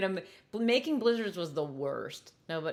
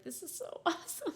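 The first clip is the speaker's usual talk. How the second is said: laughing awe; 10 semitones higher, 7 dB quieter than usual. Retold or imitated. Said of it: "I was like".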